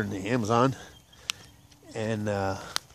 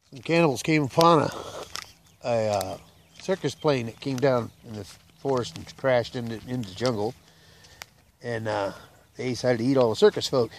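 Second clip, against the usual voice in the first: ordinary delivery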